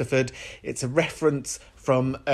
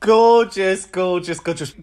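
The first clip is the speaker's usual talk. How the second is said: loud, higher gushing gorgeous; excited; 5 semitones higher, 4 dB louder than usual. Real, not acted. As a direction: gush over it. Host reacts excitedly.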